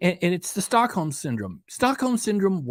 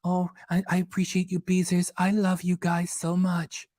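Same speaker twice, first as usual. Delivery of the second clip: mock sweet talk; 4 semitones higher than usual. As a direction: mimic a cloying thank-you. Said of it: "and then it's like".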